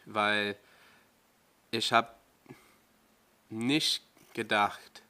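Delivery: halting German, with pauses between phrases; German accent in a slightly changed voice; careful German pronunciation. Real, not acted. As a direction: lightly mimic how German sounds, searching for words. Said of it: "my voice changes when I speak German".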